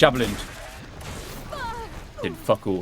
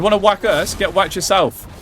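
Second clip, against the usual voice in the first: higher, louder trash talk; taunting boss; 8 semitones higher, 3 dB louder than usual. Real, not acted. Gamer taunting the boss after a hit, high cocky jeer. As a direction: throw it back at him.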